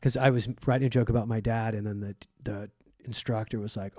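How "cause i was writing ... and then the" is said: normal talking voice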